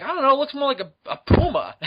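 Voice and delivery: Griff. Retold or imitated. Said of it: "He's like".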